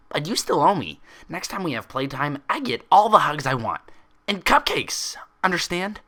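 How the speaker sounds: high, loud, bouncy little-pony voice; bubbly pony demand. Acted. Pinkie insisting to Twilight, high and loud. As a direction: insist like an excited child making a deal.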